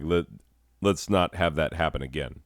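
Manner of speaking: usual speaking voice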